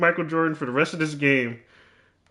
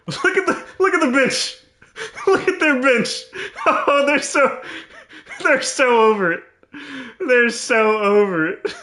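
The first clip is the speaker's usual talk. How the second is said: much higher, giggling through words; cracking up at the bench; laughing; 11 semitones higher than usual. Real, not acted. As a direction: laugh while pointing it out.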